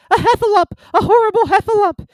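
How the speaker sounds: high squealed alarm, loud; Piglet. A narrator's reading. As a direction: raise the alarm in a tiny terrified voice. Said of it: "cried Piglet".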